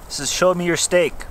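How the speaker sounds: usual speaking voice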